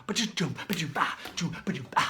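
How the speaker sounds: beatboxed doom ba-doom drum groove; imitating the song's drums; vocal drum beat. Shown as a demonstration. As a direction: mouth the drum rhythm. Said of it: "The drums".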